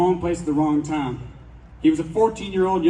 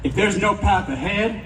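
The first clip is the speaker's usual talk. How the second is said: defiant shout; 4 semitones higher, slower than usual. Real, not acted. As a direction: hurl the last line out slowly. Defiant closing line.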